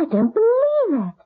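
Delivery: high and choked up; near-sobbing disbelief; tearful disbelief. Acted. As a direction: voice cracking with dismay. Shocked at the boy's age.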